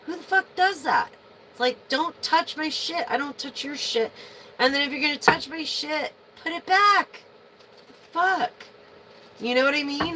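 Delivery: high, loud, exasperated rant; real frustration boiling over into a loud, high rant; angry outburst. Real, not acted. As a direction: blow up at whoever moved it. Ranting about people touching her things.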